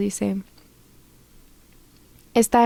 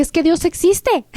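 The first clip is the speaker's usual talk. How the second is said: higher-pitched, joyful exclamation; emoción gozosa; 6 semitones higher than usual. Retold or imitated. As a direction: blurt it out, overjoyed to share it. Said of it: "con una emoción, con un gozo". (con emoción y gozo)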